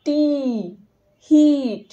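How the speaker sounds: হিট with a drawn-out long ee; long ee demo. Shown as a demonstration. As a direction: stretch the ee in হিট. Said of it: "এটা একটু long উচ্চারণ হয়".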